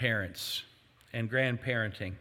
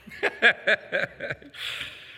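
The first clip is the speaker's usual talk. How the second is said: laughter; 7 semitones higher than usual. Real, not acted. Laughs after his spoil-them joke.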